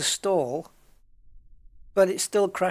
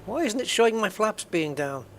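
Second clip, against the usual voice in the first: surprised; 7 semitones higher, 4 dB louder than usual. Real, not acted. Flaps not showing, confused.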